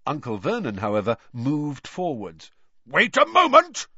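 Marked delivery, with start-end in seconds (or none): 2.9-3.9 high, loud, indignant shout; bellow. A narrator's reading. Uncle Vernon bellowing at the fire, indignant. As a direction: bellow to stop someone. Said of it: "he bellowed at the fire".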